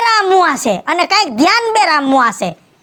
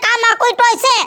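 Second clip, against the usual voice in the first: fed-up scolding; 5 semitones higher than usual. Acted. Wife fed up with deaf husband.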